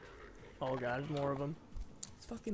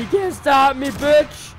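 loud defiant yell at the monster; taunting shout; 4 semitones higher, 7 dB louder than usual. Real, not acted. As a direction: shout it in its face. Taunting enemy while fleeing.

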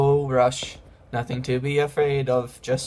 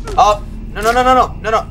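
much higher, loud frantic no's; terrified rapid protests; panicked screaming; 10 semitones higher, 7 dB louder than usual. Real, not acted. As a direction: panic as it charges. Chased by monster.

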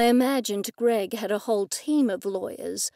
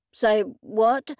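distracted, offhand question; only half listening; 4 dB louder than usual. A narrator's reading. Blake answers Sybil's phone call, distracted. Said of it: "Blake asked in a distracted tone".

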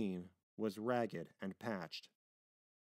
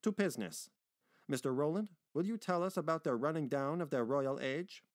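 higher, brisk chairman's voice; louder tone; 5 semitones higher, 4 dB louder than usual. Acted. Meyer asking the sailor for his account, louder tone. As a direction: speak up and get down to business. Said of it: "he added in a louder tone".

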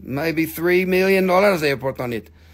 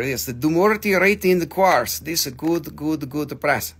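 usual speaking voice

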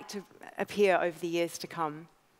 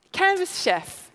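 higher, slow, hammed-up product name; mock-grand announcement; 10 semitones higher, slower than usual. Founder to audience, mock-proudly unveiling her old name, hammed up.

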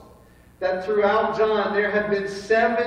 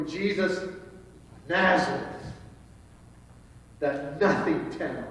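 mildly dismissive, pause before Nazareth; 4 semitones lower, 5 dB quieter than usual. Shown as a demonstration. Guards naming a man from a nothing town, dismissive. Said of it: "not how they would have pronounced it".

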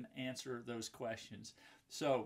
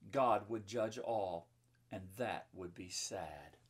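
put-on reading to children; 5 dB louder than usual. A narrator's reading. Reading the sad turn of the story to children, dramatic read-aloud. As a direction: build the drama, then soften at the end.

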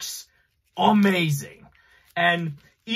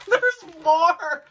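excited; 13 semitones higher, 5 dB louder than usual. Real, not acted. Excited at more wrap.

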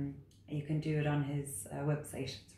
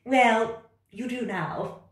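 loud, high, bossy cat voice; 8 semitones higher, 8 dB louder, slower than usual. Acted. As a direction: order the frog about like a know-it-all cat. Cat character.

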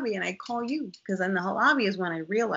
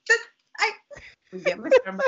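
high, laughing through the words; amused laugh while retelling; 4 dB louder, faster than usual. Real, not acted. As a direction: giggle as you tell it.

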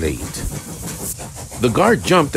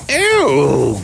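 loud, high drawn-out groan; pained groan; 8 semitones higher, 7 dB louder than usual. A narrator's reading. Van squeezed by the engine, groaning. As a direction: groan as if being crushed. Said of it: "groaned the van".

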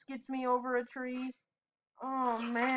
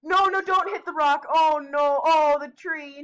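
panicked yell; 3 semitones higher, 9 dB louder than usual. Real, not acted. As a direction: yell at the screen as he flies at the rock. Character hurtling into rock.

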